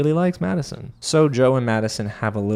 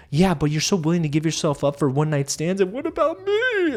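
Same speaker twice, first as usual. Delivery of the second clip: rising to a very high whine; mock whiny crying; 6 semitones higher than usual. Edmund whining at Calabria, mock crying voice. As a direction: play him as a wounded guy whining through tears. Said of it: "he starts, like, crying".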